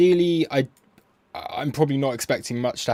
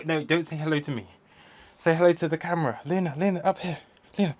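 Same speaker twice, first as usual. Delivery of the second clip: pet talk; 6 semitones higher, faster than usual. Real, not acted. Coaxing dog to look at camera.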